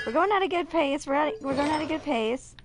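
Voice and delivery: usual speaking voice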